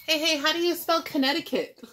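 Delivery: high, loud comic query; puzzled character calling. Acted. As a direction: call out for spelling help. State character calls out a question.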